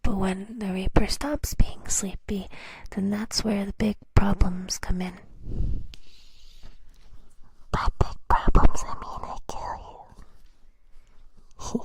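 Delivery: teasing threat in a loud whisper; mock menacing. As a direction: play the cute death threat. Reaper threatens the listener.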